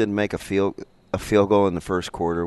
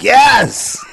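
excited yes; 14 semitones higher, 10 dB louder than usual. Retold or imitated. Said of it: "And I was like".

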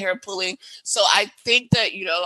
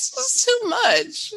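high drawn-out mock; much slower than usual. High, slow, mocking.

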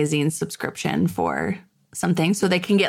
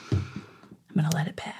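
whispered aside to herself; whispering; 2 semitones lower, 8 dB quieter than usual. Real, not acted. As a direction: let it pass in a whisper. Holding back a salty story.

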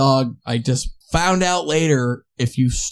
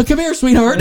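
higher, sarcastic sugary call to a runaway dog; mock sweet coaxing; 9 semitones higher, 4 dB louder than usual. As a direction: sweet-talk the dog through a laugh. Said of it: "So I'm like".

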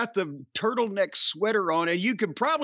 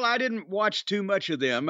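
ordinary talk, nothing marked

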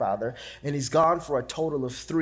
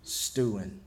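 dropped low and soft, drawn out; low, quiet stewing; 6 semitones lower, 7 dB quieter than usual. Shown as a demonstration. Preacher answering his own question, low and soft. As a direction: let the word sink, lower and softer. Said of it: "What y'all think he was doing".

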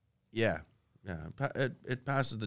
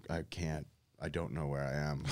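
low, soft, hurried mumble; lost and bewildered, under the breath; retold bewilderment; 8 semitones lower, 10 dB quieter, faster than usual. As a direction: replay it quietly, as if just waking. Retold waking lost in hotel.

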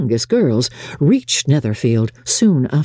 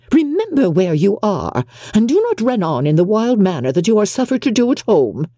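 high-pitched, fussy reprimand; scolding mother; 7 semitones higher than usual. A narrator's reading. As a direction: scold your daughter in a high voice. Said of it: "cried her mother".

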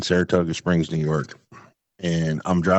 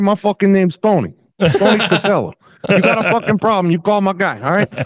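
much higher, louder mobster put-on; mob movie tough guy; 13 semitones higher, 5 dB louder than usual. Business owner posing as Tony to loan sharks, mobster voice. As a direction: bluster like a movie mobster, pitched way up. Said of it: "I watch a lot of mob movies".

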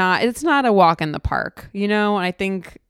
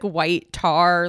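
plain reading voice

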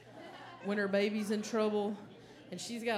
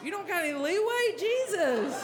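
mock outrage; 8 semitones higher than usual. Customer outraged by the worker's two-minute limit: high, laughing indignation. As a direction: act scandalized at the deadline.

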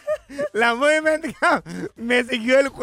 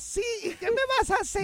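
higher playful coy voice; teasing mother-in-law; 12 semitones higher, 5 dB quieter than usual. Pretend. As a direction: tease him like a sly mother-in-law.

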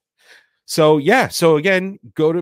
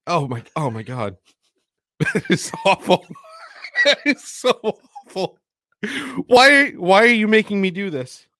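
higher, laughing in disgust; disgusted laughter; 7 semitones higher than usual. Real, not acted. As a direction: laugh through the gag. Reacting to foul drink.

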